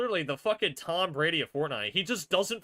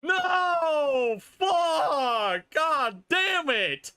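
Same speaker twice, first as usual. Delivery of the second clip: high, loud howls of defeat; furious shouting; 12 semitones higher, 5 dB louder, much slower than usual. Real, not acted. Fan cursing Peterbot's win, real furious shouting. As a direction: shout each curse, high and drawn out. Cursing the loss at full volume.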